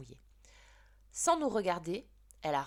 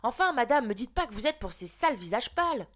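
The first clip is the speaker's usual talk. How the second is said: higher and louder, outraged schoolboy; indignant shout; 5 semitones higher, 6 dB louder than usual. Acted. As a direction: shout your protest at the teacher. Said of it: "Benjamin qui criait".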